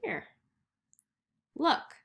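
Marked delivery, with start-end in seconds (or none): 1.5-2.0 high, loud look; excited look. Acted. Teacher showing the class the next picture, excited. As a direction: call their attention brightly. Pointing at each page's reveal.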